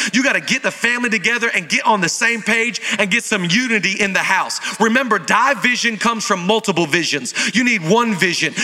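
usual speaking voice

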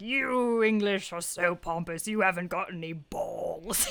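slow, drawn-out mock American jeer; mock obnoxious American. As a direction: stretch it out like a comic American insulting the English. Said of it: "have a good go at the Americans".

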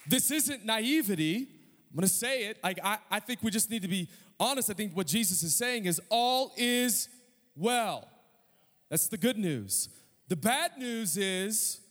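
ringing gospel proclamation; impassioned preaching. Real, not acted. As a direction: proclaim good news to a congregation with full conviction. Preaches at full force.

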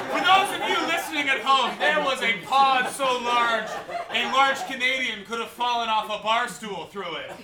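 high, loud announcement over laughter; big incredulous comic proclamation; loud mock commentary. Real, not acted. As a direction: project it to the listeners at home. Host projects mock aside to listeners.

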